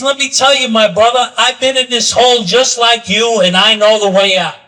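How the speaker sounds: loud, forceful declaration starting high and stepping down; emphatic assurance. Retold or imitated. Rescuer tells trapped brother he knows the way out, loud and emphatic. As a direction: proclaim it loudly as the rescuer. Rescuer reassures man in hole, story climax.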